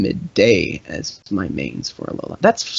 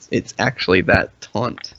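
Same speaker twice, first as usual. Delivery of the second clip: ordinary delivery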